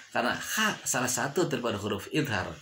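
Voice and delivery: ordinary delivery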